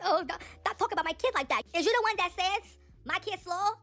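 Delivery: lightly voiced mocking of a rapper mother defending her kid. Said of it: "That's how she sounds".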